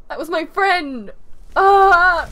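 loud, drawn-out wail of loss; emotional outcry. Real, not acted. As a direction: cry it out, dragging the words, upset. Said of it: "Oh, I'm emotional".

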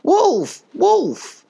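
high, loud silly sheep cries; crying wolf. A narrator's reading. Silly sheep fleeing a dog, high panicky cries. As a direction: bleat out two high alarm calls. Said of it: "would run around aimlessly crying".

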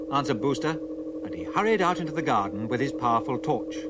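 plain reading voice

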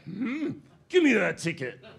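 snatch the ticket like a thug; mobster demand. Acted.

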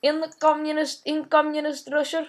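thick put-on Russian accent, broken English; heavy Russian accent menace. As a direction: play a comic Russian gamer with a heavy accent. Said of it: "Shut up, talking like that".

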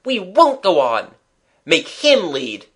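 loud, high shouts of refusal; alarmed crowd refusing, easing slightly by the end; crying out. A narrator's reading. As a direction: cry out in protest, full voice. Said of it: "Cries of".

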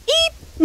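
a tiny, very high eep; high fright squeak. Acted. Sonny squeaks at Espoir's answer, high and tiny. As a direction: squeak like a startled mouse.

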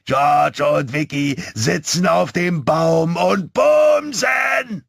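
high, drawn-out taunting shout like a donkey's bray; braying yell. A narrator's reading. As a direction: bray it across the street, very slowly and loudly. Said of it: "eher ein Eselsgeschrei".

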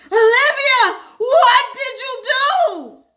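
high, loud, drawn-out shriek; horrified shriek. Acted. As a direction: shriek it at your sister in horror. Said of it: "shriek like that before".

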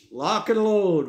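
mock action-hero swagger; mock tough guy. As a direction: ham up a gun-toting tough guy. Mocking a gung-ho gun stance.

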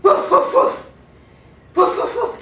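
loud yapping dog barks, high and sharp. Retold or imitated. Mimicking the dogs chasing the hog, loud high barking. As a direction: bark like a pack of hunting dogs.

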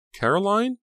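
ordinary delivery, nothing marked

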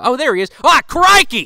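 high, loud Australian crikey; Australian accent exclamation; Steve Irwin Australian accent. Pretend. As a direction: burst out like a hammed-up Australian wildlife host. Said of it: "I'm losing the accent already".

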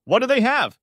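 high, loud holler across the room; calling back. A narrator's reading. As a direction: yell the question back. Friend yells back across the room.

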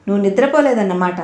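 plain reading voice

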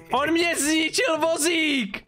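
mock outrage. Mock outrage at broken cart.

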